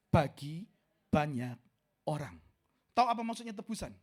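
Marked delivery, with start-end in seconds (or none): 0.1-2.3 loud, measured Bible reading; scripture reading. Acted. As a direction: read the verse out with weight. (membaca ayat dengan lantang)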